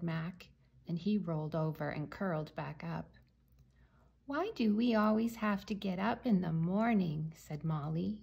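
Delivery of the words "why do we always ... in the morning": ordinary delivery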